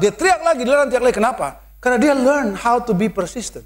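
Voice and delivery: ordinary delivery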